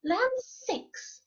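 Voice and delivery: high, scandalized bear exclamation; middle-sized Mama Bear voice. A narrator's reading. Mama Bear finds someone has been there, middle-sized voice. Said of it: "in her middle-sized voice".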